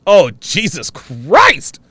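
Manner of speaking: ordinary talk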